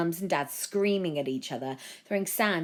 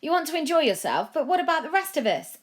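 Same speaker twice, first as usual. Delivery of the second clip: much higher, louder shriek; shrieking woman; 11 semitones higher, 6 dB louder than usual. A narrator's reading. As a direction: shriek the accusation. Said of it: "a woman shrieked".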